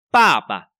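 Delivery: first bà loud and falling, second light; stress on first bà. Shown as a demonstration. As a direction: punch the first bà hard. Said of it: "Ở đây chúng ta phải thật chú ý này". (nhấn mạnh bà đầu)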